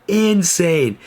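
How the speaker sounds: high, loud and thrilled; excited exclaim. Real, not acted. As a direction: shout it again, amazed. Repeats hype word.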